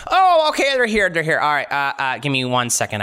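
starts very high, settles down to normal; flustered blacksmith. Acted. Blacksmith turning round to find visitors, flustered high start settling down. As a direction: burst out high, then calm yourself.

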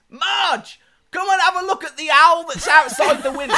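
imitated kid yelling the punchline, very high and loud; Sonny. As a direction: mimic a child hollering for Marge. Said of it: "And he goes".

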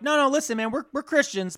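high-pitched pleading objection; acted Cathar protest; mock protest. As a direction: push back in a high voice, easing down. Acting Cathars answering the preachers.

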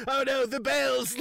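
high, hammed-up Quasimodo cry; mock-dramatic wail about the bells; imitating hunchback Clouseau. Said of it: "he's like".

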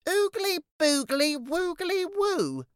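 slow, very high singsong spell; child reading aloud. A narrator's reading. Jackson reading the words on the lid, slow chant. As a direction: chant the silly magic word slowly, pitched high. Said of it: "read them out loud".

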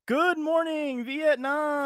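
high, drawn-out radio DJ wake-up call; catchphrase holler. Acted. Host reveals the quiz answer, drawn-out DJ holler. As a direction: stretch it out big like a movie catchphrase. Performs the famous film catchphrase.

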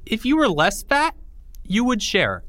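Timmy voiced high and bright-toned. Timmy teasing Porge about sharing, in a high kid voice. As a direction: pitch up high for the little boy.

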